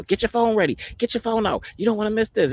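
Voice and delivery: high-pitched bustling mimicry; excited urging. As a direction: imitate staff hurrying dad to get ready. Staff urging him, acted out.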